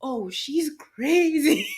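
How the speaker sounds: high, slow mimic of a shocked lady; mimicking a lady. Passing shopper remarking on manager, high slow mimic. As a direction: drawl it out like a startled shopper. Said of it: "the lady goes".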